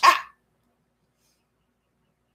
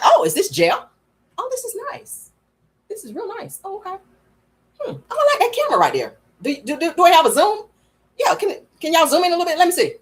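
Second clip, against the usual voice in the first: mock cheerful Marlo; 8 semitones higher than usual. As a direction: play Marlo loving her mugshot. Marlo voiced happy in jail.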